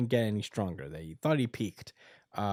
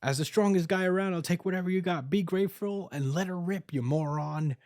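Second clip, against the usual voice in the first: higher, cocky, fast swagger; arrogant swordsman; 7 semitones higher than usual. Retold or imitated. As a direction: sneer it like a cocky rival. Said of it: "he's like".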